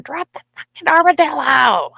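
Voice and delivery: high, loud yelled command; retold yell. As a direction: holler it across the yard at a dog. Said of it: "running down the yard going".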